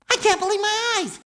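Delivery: high, fast amazement; excited disbelief. Acted. Sponge marvelling at the museum, high excited disbelief. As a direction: blurt it out, thrilled.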